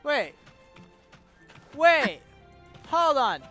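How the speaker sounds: ordinary delivery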